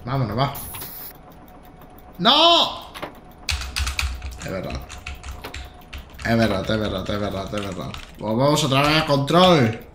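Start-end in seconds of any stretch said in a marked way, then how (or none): 2.2-2.9 high yelped no, far above his usual pitch; sharp cry of no. Real, not acted. Driver at the controls crying out in sudden alarm. Sudden outcry while driving. 4.4-7.9 much lower and softer, repeating it under his breath; low muttering to himself. Real, not acted. Driver muttering to himself after a scare, low and soft.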